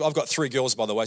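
normal talking voice

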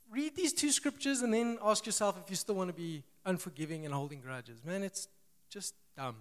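ordinary talk, nothing marked

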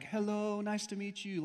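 high, sing-song hello, held on one note; lightly voiced mom saying a sweet hello; greeting mother. Voicing roommate's mother greeting him.

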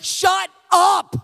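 very loud, high-pitched yell; high, booming outburst of a fed-up parent; furious shout. Retold or imitated. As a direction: explode at the kids at full volume. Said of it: "that's about how I said it".

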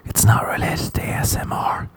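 apologetic unvoiced whisper; doctor whispering. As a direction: whisper an excuse to the patient. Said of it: "And he'll say".

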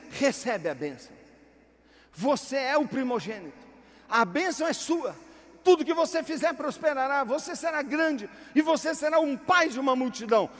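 a blessing that builds to a loud shout; old father Isaac rising to a shouted blessing; father's blessing. Acted. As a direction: proclaim it like a patriarch. Isaac blesses son, voiced.

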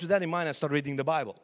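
ordinary talk, nothing marked

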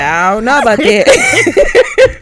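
laughing through the words; quoting herself while cracking up; laughing, slow. Retold or imitated. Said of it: "I said".

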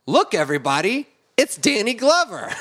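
high, loud call to the room; excited announcement. Acted. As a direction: announce a star's arrival to everyone. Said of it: "he was supposed to say".

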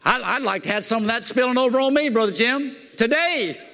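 high, eager preacher's appeal; fired-up preaching. Real, not acted. Preacher calling to Brother Jim for a blessing, fired-up preaching. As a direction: preach it high, lifting the last word.

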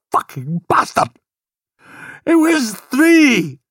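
furious Scottish father bellowing; exploding in rage. Acted. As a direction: roar it out in grief and rage. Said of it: "her father exploded".

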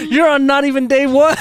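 high, loud mock disbelief; hammed-up exasperation at a crying friend; incredulous. Retold reply to sobbing friend.

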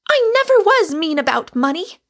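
high, very loud protest; furious cry. A narrator's reading. Rosamond defends herself over money, crying furiously. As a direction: protest at full furious volume. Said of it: "cried Rosamond furiously".

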